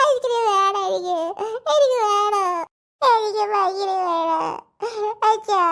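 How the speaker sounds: sobbing demand building to a slow shout; crying tantrum. Acted. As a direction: bawl for the ice cream like a spoiled kid. Child demands ice cream crying.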